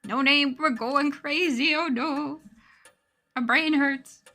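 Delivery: high, loud hammed-up panic; mock panic. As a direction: wail it in fake alarm. Mock panic over amnesia.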